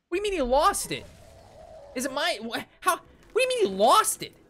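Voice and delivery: high, loud incredulous rant; retold fury at a teacher who lost the work; outraged yelling. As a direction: demand an answer, voice cracking up high.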